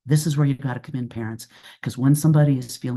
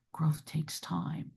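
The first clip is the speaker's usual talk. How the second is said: quiet, slow restatement, much softer; 2 semitones higher, 11 dB quieter, slower than usual. Real, not acted. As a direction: say it gently, almost to yourself. Repeats key point softly.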